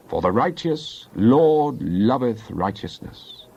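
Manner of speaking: usual speaking voice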